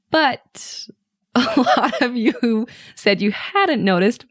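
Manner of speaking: breaking into laughter while talking; real giggles running through the words; laughing. Real, not acted. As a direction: talk through a laugh, pitch jumping up. Amused at the poll result.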